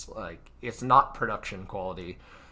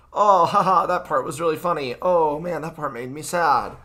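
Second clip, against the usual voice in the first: higher, louder hammed-up reactions; hammed-up viewer reactions; 6 semitones higher, 8 dB louder than usual. Showing viewers sample reactions, hammed-up and loud. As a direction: overplay a viewer laughing then sad. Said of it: "at certain points are like".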